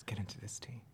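soft unvoiced ASMR whisper. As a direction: whisper close like an ASMR video. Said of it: "A S M R".

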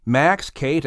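ordinary delivery

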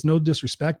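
normal talking voice

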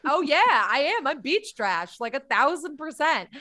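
loud, emphatic proud owning-up; proud declaration. Retold or imitated. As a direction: own the insult at full volume. Retells proudly owning the insult.

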